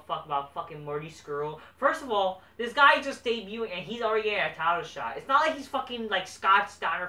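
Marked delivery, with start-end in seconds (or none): 1.8-7.1 loud, high-pitched exasperated rant; furious wrestling fan ranting; angry rant. Real, not acted. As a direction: vent at full volume about the booking. Ranting about undeserved title shot.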